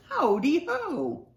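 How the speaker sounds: high, drawn-out sing-out hello; jolly greeting. A narrator's reading. Hare hops along and greets friends, jolly greeting. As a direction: stretch it out as a greeting.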